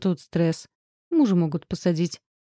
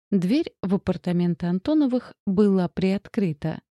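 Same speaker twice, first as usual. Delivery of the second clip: usual speaking voice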